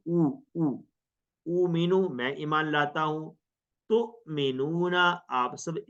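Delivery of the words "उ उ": a drawn-out demo u, held apart; isolated u sound. Shown as a demonstration. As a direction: sound out the bare vowel u for students. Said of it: "इसके अंदर हमजा को ऐसे नहीं पढ़ना".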